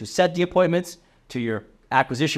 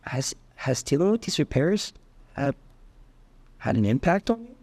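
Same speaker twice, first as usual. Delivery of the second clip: empathetic probing. Shown as a demonstration. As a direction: ask it with real empathy and care. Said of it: "with a lot of empathy and care".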